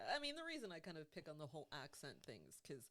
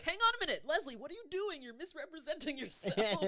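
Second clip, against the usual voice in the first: high, loud comic alarm; mock self-scolding; 13 semitones higher, 13 dB louder than usual. Host pretends to stop herself misrepresenting her taste, mock-alarmed scolding. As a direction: call yourself out in exaggerated outrage.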